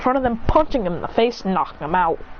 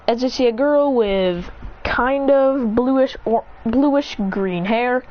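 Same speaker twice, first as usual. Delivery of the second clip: usual speaking voice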